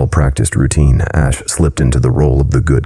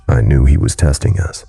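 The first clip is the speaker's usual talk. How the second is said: ordinary delivery, nothing marked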